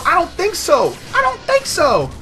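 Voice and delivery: very high, loud squeal of defiance; high-pitched taunt. Real, not acted. Gamer taunting a camper, high squealed taunt. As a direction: squeal it at your rival. Mock taunt at camping player.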